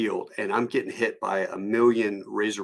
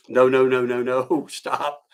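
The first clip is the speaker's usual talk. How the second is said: loud rapid protest, rising in pitch; mock panic; 3 semitones higher, 5 dB louder than usual. Husband stopping wife before the cop sees, mock panic. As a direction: re-enact a panicked plea to stop.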